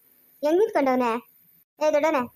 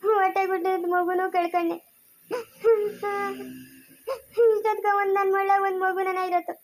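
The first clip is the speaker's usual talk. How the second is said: tearful lament; 3 semitones higher than usual. Acted. Wife weeping to her mother over her husband. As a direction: lament her fate through tears. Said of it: "ಅಳ್ಬೇಡ ಕಲ್ದಿರೋರೆ".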